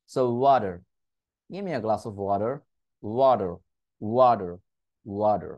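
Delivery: native-style water with flapped t; American flap t. Shown as a demonstration. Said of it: "ডি sound".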